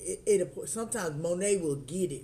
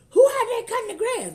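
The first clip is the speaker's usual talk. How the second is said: squeaky high, fast, louder mock voice; high mock husband; 12 dB louder, faster than usual. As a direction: pipe it up high like a surprised husband. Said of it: "he was like".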